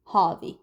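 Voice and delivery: "هذه" as "HAW-dhi", back vowel; heavier first syllable. Shown as a demonstration. Teacher repeating for learners, heavy first syllable. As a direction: say "هذه" short, with a heavy opening. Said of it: "make the first syllable heavier".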